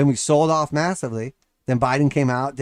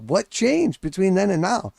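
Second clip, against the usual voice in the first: incredulous; 6 semitones higher than usual. Retold or imitated. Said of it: "And you're like".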